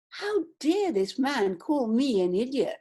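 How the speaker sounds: ordinary talk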